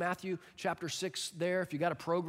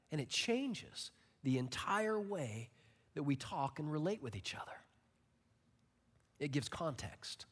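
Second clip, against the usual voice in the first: hushed emphasis; 4 semitones lower, 8 dB quieter than usual. Real, not acted. Closing point hushed.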